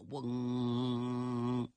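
long, low engine hum; imitated plane drone. A narrator's reading. Imitates approaching enemy planes. (低沉地模仿飞机嗡鸣)

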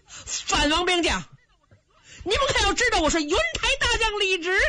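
ringing high-pitched warrior's announcement; shouted challenge. A narrator's reading. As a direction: call out your name to a whole army. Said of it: "他喊了半天".

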